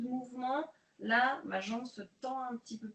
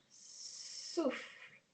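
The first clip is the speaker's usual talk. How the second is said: breathed-out cue; 2 semitones higher, 5 dB quieter than usual. Shown as a demonstration. Instructor cueing her class to exhale, breathy whisper.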